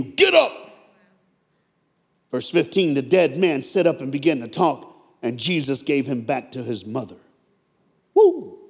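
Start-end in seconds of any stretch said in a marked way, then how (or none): 0.0-0.5 sudden high-pitched cry of command; commanding shout. Acted. Acting Jesus commanding the dead man. 8.1-8.6 short high-pitched "woo"; excited whoop. Real, not acted. Preacher exclaims after the reading.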